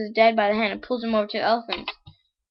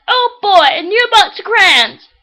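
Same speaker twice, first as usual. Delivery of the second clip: high, loud, excited squeal; delighted child; 11 semitones higher, 13 dB louder, slower than usual. Acted. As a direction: cry out as a thrilled little boy. Delighted child voiced in joke.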